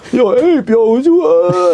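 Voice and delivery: high incredulous calling out; mock outrage. Waking a friend named Ape, high mock outrage. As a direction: call him out in high disbelief. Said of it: "And I'm just like".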